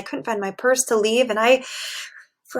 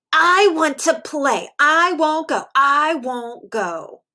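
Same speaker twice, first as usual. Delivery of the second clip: higher, slow whiny refusal; stubborn child refusing; 4 semitones higher, much slower than usual. Acted. Percy refusing his mommy at Frida's gate, stubborn whine. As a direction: dig in like a stubborn kid.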